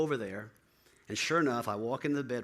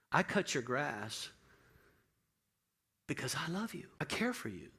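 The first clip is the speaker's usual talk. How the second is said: tender reassurance; 3 semitones higher than usual. Retold or imitated. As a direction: comfort a broken man gently. Tender words to the neighbor.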